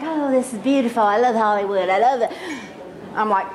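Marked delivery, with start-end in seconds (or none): 0.0-2.3 mother's gushing voice. Retold or imitated. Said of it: "She's like".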